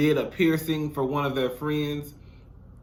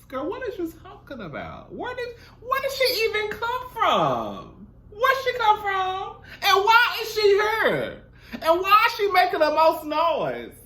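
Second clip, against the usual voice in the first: baffled disbelief; 13 semitones higher than usual. Real, not acted. Baffled at loud stranger.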